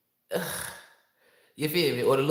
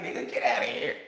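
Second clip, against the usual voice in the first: laughing, half-breathed get outta here; laughing disbelief; 3 semitones higher than usual. Retold or imitated. The watching girls laughing off the New Orleans man. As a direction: crack up while brushing it off. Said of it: "everybody we was out there like".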